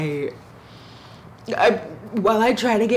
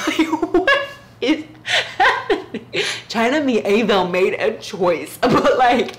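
high laughing disbelief; cracking up at the book's choice; 4 semitones higher than usual. Real, not acted. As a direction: laugh through the words, pitch way up. Laughs at the character's voice.

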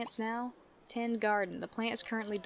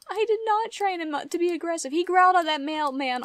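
indignant repeat; 7 semitones higher, 6 dB louder than usual. Real, not acted.

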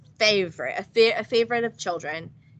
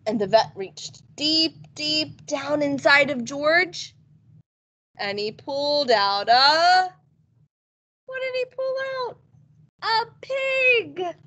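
suspense build; 5 semitones higher than usual. A narrator's reading. Reveal in story.

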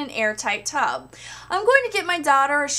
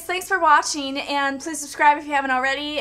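ordinary talk

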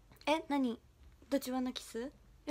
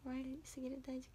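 whisper; 13 dB quieter than usual. Real, not acted. Flustered by fan's compliments.

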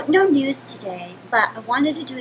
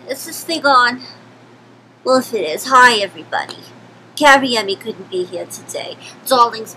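put-on Yiddish accent; 10 dB louder than usual. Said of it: "my name is Sylvia Goldenberg".

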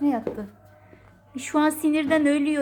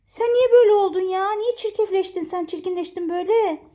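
raised voice, loud at the start; higher-pitched telling-off of a naughty pet; scolding; 5 semitones higher, 4 dB louder than usual. Real, not acted. (sesini yükselterek azarlama)

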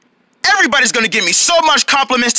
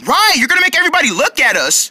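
ordinary delivery

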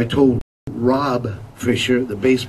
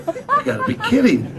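incredulous disbelief. Retold or imitated. Band reacting in disbelief.

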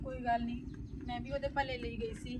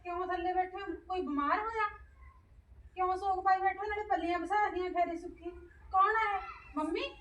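alarmed questions; 9 semitones higher, 5 dB louder than usual. Acted. Returning wife alarmed at mourning.

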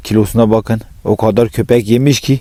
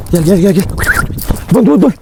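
high calls to the dogs; calling dogs; 8 semitones higher than usual. Real, not acted. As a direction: call the dogs over, high and bright. Calling his dogs over.

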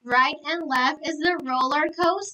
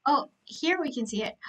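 ordinary talk, nothing marked

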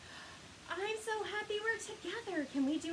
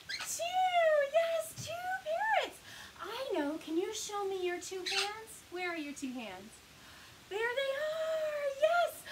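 excited; 8 semitones higher, slower than usual. Real, not acted. Counting parrots with kids.

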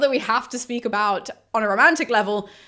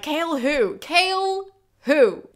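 high, exaggerated "who's that?"; mock dismissive; 8 semitones higher, 4 dB louder, much slower than usual. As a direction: climb higher on the repeat, playfully dismissing him. Acts out reader forgetting old love.

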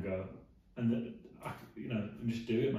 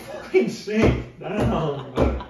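loud mock order, higher and louder; a big jokey yell to sit down; mock shout; 5 semitones higher, 10 dB louder, much slower than usual. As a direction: bark it at your mate playfully.

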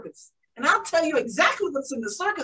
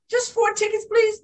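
put-on pleading child; 7 semitones higher than usual. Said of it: "I said".

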